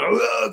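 a high, barked growl like a mad mastiff; mock guard-dog snarl; imitated dog growl. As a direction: voice the furious dog. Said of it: "I had a big bull mastiff going".

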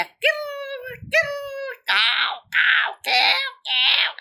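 rolled rattle rising into high caws; crow calls. A narrator's reading. Papa crow answers his child's request, cawing. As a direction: imitate a crow's croaks and drawn-out squawks. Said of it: "પપ્પા બોલ્યા".

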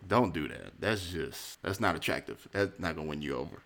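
quiet, low, lecturing put-down. Retold or imitated. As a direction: drop it low and tell her off. Lecturing her, lowered.

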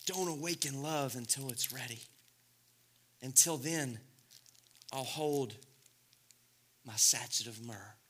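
quiet reverent reading; soft reading. Acted. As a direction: read it close and gentle.

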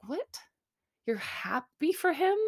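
high-pitched disbelieving question; incredulous. Retold or imitated. Retold incredulous reaction to her.

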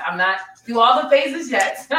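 laughing through tears. Real, not acted. Admitting she is still healing.

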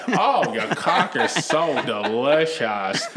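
slow, hammed-up Queens woman; mock seductive Queens accent, every word stretched; New York accent. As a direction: drag it out in exaggerated New York. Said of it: "that fucking accent you hear from".

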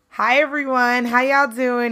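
ordinary talk

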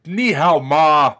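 slow, loud mock Chinese phrase; hammed-up fake Chinese greeting. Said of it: "be like".

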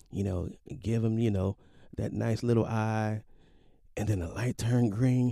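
plain reading voice

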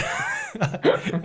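a burst of high-pitched laughter. Real, not acted. Laughing at his own retold yell, high and bursting. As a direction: crack up laughing.

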